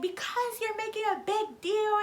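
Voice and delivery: high, slow mimicked complaint; mock exasperation. Boyfriend answering her on the phone, mocked exasperated complaint. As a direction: imitate his defensive whine, dragged out.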